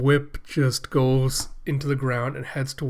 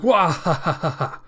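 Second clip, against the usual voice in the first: villain laugh; 5 semitones higher, 4 dB louder than usual. Acted. Makaku laughing.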